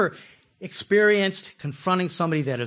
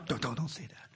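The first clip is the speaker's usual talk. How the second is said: low hushed scolding; a parent quietly hushing a child; hushed mother; 4 semitones lower, 11 dB quieter than usual. Retold or imitated. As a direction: mom hushing her kid under her breath.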